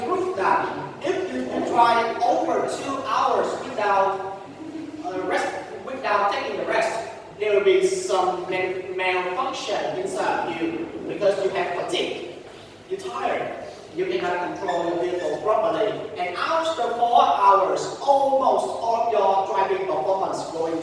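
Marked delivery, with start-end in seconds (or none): none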